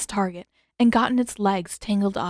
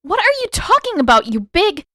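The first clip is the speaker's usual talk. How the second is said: much higher and louder, raging at the spirit; furious outburst; 12 semitones higher, 8 dB louder than usual. A narrator's reading. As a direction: snap at it in fury. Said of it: "Frustration and rage flashed across her face".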